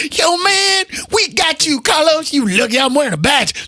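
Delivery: high-pitched hammed-up buddy voice; exaggerated cop impression. Cop backing the comic offstage, exaggerated mock voice. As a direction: caricature a policeman with your pitch way up. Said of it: "No, he didn't talk like that".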